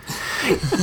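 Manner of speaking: light high laugh; a breathy little laugh; soft laugh. Acted.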